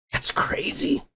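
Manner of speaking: normal talking voice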